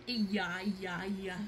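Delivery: low, very soft comforting murmur; soothing. Pretend. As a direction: croon quietly to settle a crying doll. Soothing a crying toy.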